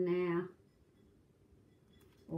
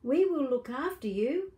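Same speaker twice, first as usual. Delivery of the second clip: mummy voice; 6 semitones higher, 6 dB louder than usual. Acted. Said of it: "said the boy's mummy".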